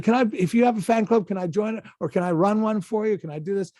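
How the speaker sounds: retold star-struck pleading, tumbling out; gushing fan. A won-over fan begging the actor to let her help, gushing. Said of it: "like, I went crazy".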